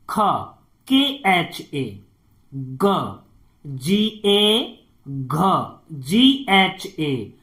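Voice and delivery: each letter then its spelling, slow and deliberate; teacher recites the alphabet slowly for copying; teacher reading consonants and spellings slowly to pupils; slow consonant drill. Shown as a demonstration. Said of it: "सही से उच्चारण भी पढ़ते जाएंगे".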